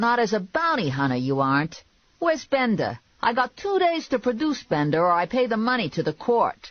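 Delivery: high, insistent character voice; pushy, griping boss. A narrator's reading. Boss demanding she bring in Bender, pushy.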